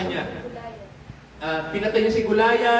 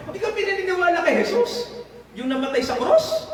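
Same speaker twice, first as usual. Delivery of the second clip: high mock denial; 8 semitones higher than usual.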